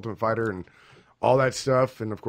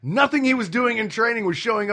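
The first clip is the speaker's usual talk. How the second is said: excited rant; 13 semitones higher, 9 dB louder, slower than usual. Real, not acted. Ranting about fighter.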